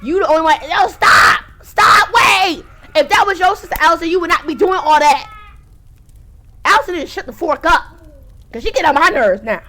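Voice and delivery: loud frustrated yelling; yelling at screen. Real, not acted. Streamer yelling at Allison on screen, furious. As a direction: shout her down, fed up.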